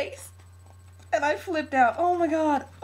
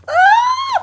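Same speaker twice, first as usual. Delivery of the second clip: tearful gasp into high scream; very high, loud happy-crying squeal; freaked-out scream; 12 dB louder than usual. Real, not acted. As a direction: shriek with overwhelmed joy. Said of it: "I'm, like, freaking out".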